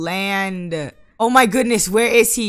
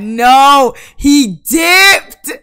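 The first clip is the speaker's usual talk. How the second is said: louder, higher, disbelieving cry; loud outburst; 6 semitones higher, 10 dB louder, much slower than usual. Real, not acted. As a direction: blurt it out loud. Sim leaves the party.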